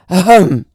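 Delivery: a loud, pointed throat-clearing ahem. A narrator's reading. Pickwick behind the curtains announces himself, loud ahem. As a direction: clear the throat loudly to get noticed. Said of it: "called out very loudly".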